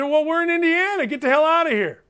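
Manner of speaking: high-pitched mock telling-off; retold indignant comeback. Host retelling how he told a fan off, mock indignant. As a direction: send him packing, play-acted.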